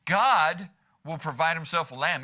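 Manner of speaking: God held and hit hard at the front; opening word God strongly emphasized; stress on GOD. Shown as a demonstration. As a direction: put all the weight on God, then ease off. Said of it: "No matter how you emphasize it".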